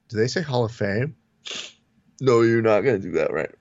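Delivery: ordinary talk, nothing marked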